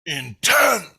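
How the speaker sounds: high, loud booming single word; echoing inner voice. Retold or imitated. Guilty heart answers the kid, loud echoing pronouncement. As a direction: ring it out like a word resounding through the whole brain. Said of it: "My whole brain echoed".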